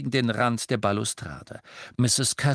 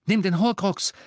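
urgent call; 10 semitones higher, 5 dB louder than usual. A narrator's reading. Harry urges Hermione.

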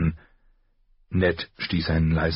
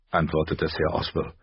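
ordinary delivery, nothing marked

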